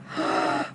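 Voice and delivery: sharp acted gasp of shock. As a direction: suck in air in mock horror. Retold shock at recognizing her.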